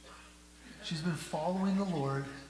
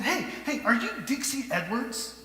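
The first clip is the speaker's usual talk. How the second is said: excited call; 8 semitones higher, 6 dB louder than usual. Retold or imitated. Resident calls out to Dixie.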